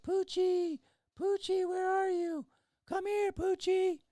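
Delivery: high, sing-song falsetto calling a pet; calling for a lost dog. Retold or imitated. Dog owner driving around calling for lost pet, high falsetto call.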